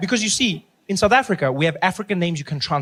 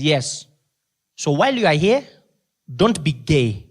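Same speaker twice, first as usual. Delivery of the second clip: louder, earnest Zambian warning; Zambian accent; 4 dB louder than usual. Retold or imitated. Chaperone Ali warning [name], loud Zambian accent. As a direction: warn him firmly in accent. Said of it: "He says".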